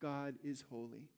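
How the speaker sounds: ordinary delivery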